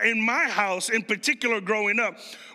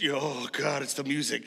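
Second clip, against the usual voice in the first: low, quiet groggy dread; waking-up groan; 8 semitones lower, 6 dB quieter than usual. Acted. Kid woken by Saturday cleaning music, low weary groan. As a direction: grumble it softly, just woken up.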